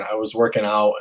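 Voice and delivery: usual speaking voice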